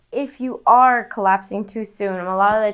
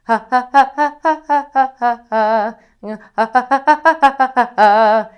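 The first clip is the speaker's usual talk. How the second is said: quick sung ha pulses, louder; faster panting scales; 3 semitones higher, 7 dB louder than usual. Shown as a demonstration. Coach speeds up the panting ha scales. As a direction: speed the panted ha up. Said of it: "you can speed 'em up".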